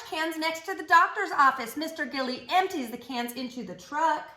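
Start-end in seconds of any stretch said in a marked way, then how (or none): none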